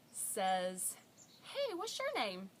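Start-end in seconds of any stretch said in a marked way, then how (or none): 1.4-2.5 high-pitched imitation of a small child; bold three-year-old. Three-year-old greeting a woman on a bench, high child voice. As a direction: pipe up as an unshy little kid. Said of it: "my three-year-old, who is far from shy".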